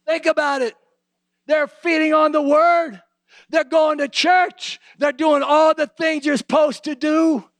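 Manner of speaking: high, slow, driving preaching; emphatic preaching. Acted. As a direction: press each phrase home slowly. Preaching climb, slow and loud.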